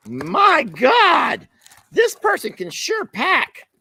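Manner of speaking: high-pitched, slowed-down astonishment; exasperated amazement. Real, not acted. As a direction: marvel at the mountain of tape. Overwhelmed by heavy taping.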